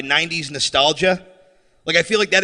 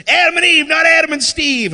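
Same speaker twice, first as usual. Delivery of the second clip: higher, louder "Adam and Eve, not Adam and Steve"; mock angry shout; 9 semitones higher, 3 dB louder than usual. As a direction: bellow the slogan like a raging bar raider.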